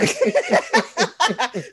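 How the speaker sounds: a high, bright burst of real laughter. Real, not acted. Laughing at her own joke.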